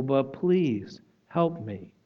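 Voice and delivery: slow, soft pleading please; slow, humbled plea for help; slow quiet plea. Retold or imitated. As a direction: draw out the plea humbly and slowly. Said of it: "To say".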